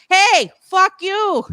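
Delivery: high, drawn-out mock yell; mock Bostonian aggression. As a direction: call it out slowly, play-aggressive. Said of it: "go full blown Bostonian".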